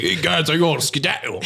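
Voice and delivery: broad mock Englishman making his exit; Benedict Cumberbatch. Fleeing the dad's gun, comic British accent. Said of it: "Your accent of Benedict Cumberbatch".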